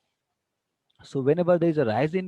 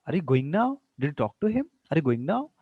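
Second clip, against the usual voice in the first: each question swinging up on "now" and "him"; rising question tone. Shown as a demonstration. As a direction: demo questions with lifted endings on "now" and "him". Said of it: "Rising tone".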